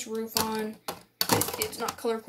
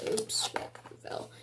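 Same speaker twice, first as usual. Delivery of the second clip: hushed oops; 10 dB quieter, slower than usual. Real, not acted. A piece drops during the tour.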